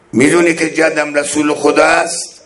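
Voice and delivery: ordinary delivery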